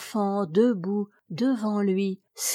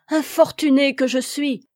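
loud anguished cry, higher; cried out; 5 semitones higher, 5 dB louder than usual. A narrator's reading. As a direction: wail it out in despair. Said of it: "s'écria-t-il".

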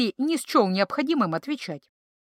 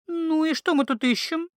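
put-on a lightly higher young woman, cold and uneasy; chilly, shivering question; 7 semitones higher than usual. A narrator's reading. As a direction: ask as if chilled in a cellar. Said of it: "Зябко... спросила травница". (зябко, поёживаясь от холода)

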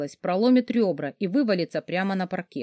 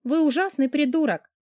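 higher, teasing grin in the voice; smirking; 8 semitones higher than usual. Acted. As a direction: toss the insult with a smirk. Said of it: "ухмыльнулась я".

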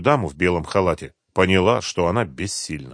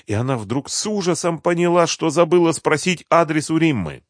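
high, tense narration; horrified, pitched well up; sudden horror; 8 semitones higher than usual. A narrator's reading.

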